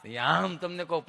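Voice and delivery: ordinary delivery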